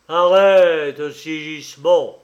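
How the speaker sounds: loud, booming voice from the tomb; sepulchral voice. A narrator's reading. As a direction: call out like a ghost in armour. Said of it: "d'une voix sépulcrale et lente comme un glas".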